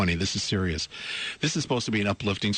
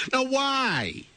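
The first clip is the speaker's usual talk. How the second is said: incredulous. Real, not acted. Host reacts to absurd story.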